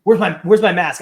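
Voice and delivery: imitating an angry colleague, loud; mock-furious demand, punched out loud; retold fuming. Wrestler storms back demanding his mask, mock fuming. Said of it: "he's just fuming".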